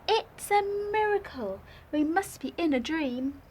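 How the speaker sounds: amazed animals. Acted. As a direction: gasp it out in wonder. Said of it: "They shouted".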